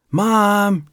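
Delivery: put-on high, loud call from a little boy; child calling out. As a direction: shout for mom across the house. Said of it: "Jeffrey called out".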